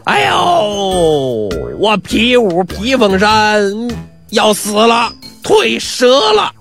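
high, drawn-out hammed-up wailing; cracked-gong voice crying out in mock pain; broken-gong yell. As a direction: howl in fake agony, slowly stretching the words. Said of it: "那扯的那破锣嗓子开喊了".

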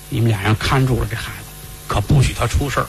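low, serious elder's voice; stern household head, low-pitched; grave master's instruction. Acted. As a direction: drop the pitch to give a warning. Master instructs the two women.

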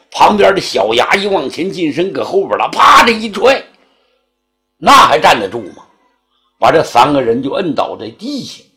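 plain reading voice

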